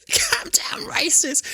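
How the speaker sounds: strained high, slow mock gasp; dying Doctor's disbelief. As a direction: drag it out in strangled mock disbelief. Re-enacting the dying Doctor's incredulous reaction.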